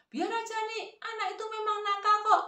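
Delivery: high-pitched dismissive granny; acted high, indignant grandma; retorting grandmother. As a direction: answer back in a high scolding voice. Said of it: "si emak tadi malah menjawab".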